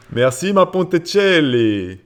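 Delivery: put-on loud, high, drawn-out Italian accent. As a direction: ham up the Italian accent, stretching the name. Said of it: "Je te dis avec l'accent".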